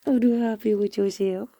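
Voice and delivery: high, loud playful puppy talk. Real, not acted. Laughing baby talk to dog.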